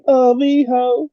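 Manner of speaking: high, slow, drawn-out cheer; excited cheer. Pretend. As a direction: call it out like a fan.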